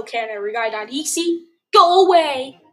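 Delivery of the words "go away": shouting. Real, not acted. Yelling at the game.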